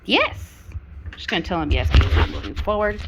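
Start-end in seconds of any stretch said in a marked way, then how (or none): 0.0-0.7 high, loud "yes" to a pet; praise for dog. Real, not acted. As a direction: mark the dog's success with a high, loud word.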